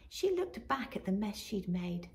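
ordinary delivery

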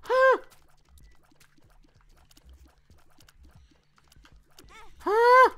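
high loud shocked huh; startled huh. Real, not acted. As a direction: yelp out in surprise. Startled in game.

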